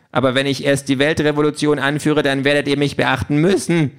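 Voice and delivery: huffy. A narrator's reading. Pink vows a world revolution to be noticed, huffy. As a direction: threaten like a sulking little tyrant. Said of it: "Pink ist aber schon eingeschnappt".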